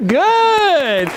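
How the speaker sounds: very high, drawn-out exclamation; delighted cheer. Real, not acted. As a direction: cheer it out with a long, high vowel. Coach cheering a student's read.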